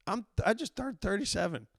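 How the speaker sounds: loud and high, throwing up his hands at it; can't believe a grown man did that; exasperated disbelief. Real, not acted. Exasperated disbelief at adult fan.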